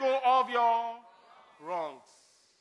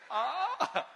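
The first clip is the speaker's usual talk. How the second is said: light laughing sigh; real amused laugh. Real, not acted. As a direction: let the laugh trail off. Laughing after the joke.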